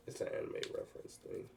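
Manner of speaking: breathy under-the-breath whisper; whispered aside. Real, not acted. Host whispering a side note to viewers about waifu, hushed. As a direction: drop to a whisper as if confiding.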